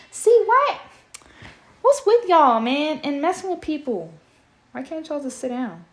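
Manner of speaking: high, loud exasperated complaint; annoyed outburst. Real, not acted. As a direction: snap at the people making noise. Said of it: "annoyed aside at noise".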